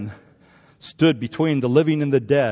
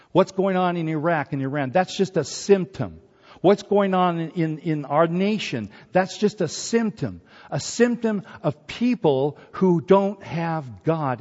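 rising, louder preaching; preaching at full force; 5 semitones higher, 3 dB louder than usual. Acted. Preacher driving home the symptoms to the congregation, rising force. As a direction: press the point harder each time.

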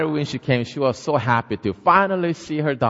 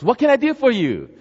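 high, louder, eager question; friendly neighbor; 11 semitones higher, 6 dB louder than usual. Acted. Neighbor asking Missus Kim what she needs, high and eager. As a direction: offer help brightly.

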